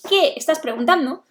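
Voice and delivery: ordinary talk, nothing marked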